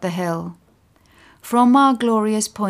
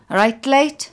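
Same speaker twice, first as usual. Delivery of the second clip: higher, louder, matey greeting; casual chat; 7 semitones higher, 6 dB louder than usual. A narrator's reading. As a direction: greet him as if just chatting. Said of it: "as if having a casual chat".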